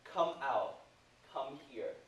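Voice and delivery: ordinary talk, nothing marked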